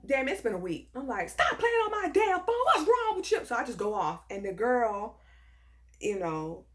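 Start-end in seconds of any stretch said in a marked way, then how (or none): 1.3-3.5 high, heated retold telling-off; going off. Woman fed up with silent prank calls, going off in a high voice. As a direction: snap at the caller, pitched way up. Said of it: "And I just go off".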